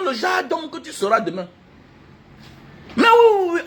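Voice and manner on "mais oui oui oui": indignant outburst. Real, not acted. Indignant exclamation.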